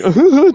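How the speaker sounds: high, loud bashful laugh; mock nervous giggle. Shy boy unable to speak to girls, mock giggle. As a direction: imitate an embarrassed boy's giggle.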